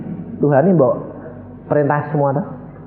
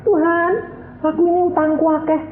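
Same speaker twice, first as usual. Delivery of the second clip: higher voice confessing debts to God; mock sheepish prayer; 13 semitones higher than usual. Preacher mocks a believer praying before the lottery, higher voice. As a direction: play a gambler asking God for luck.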